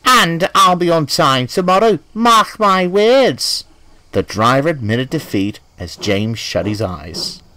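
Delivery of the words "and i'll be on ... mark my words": high, loud, slow defiant vow. A narrator's reading. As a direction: stretch out mark my words. James vows defiantly.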